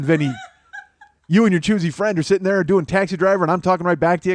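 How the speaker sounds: usual speaking voice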